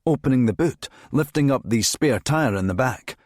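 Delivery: ordinary delivery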